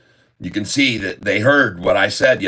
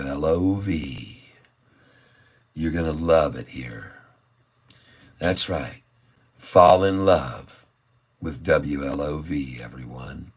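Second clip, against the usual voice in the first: smooth jazz DJ; 5 semitones lower, 4 dB quieter than usual. Acted. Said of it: "advised to bring the tone down".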